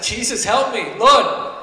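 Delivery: loud plea for help, building to a shout; crying out. Acted. Sinking Peter cries to Jesus, building to a loud shout. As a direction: call out urgently as Peter sinking. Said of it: "And he cries out".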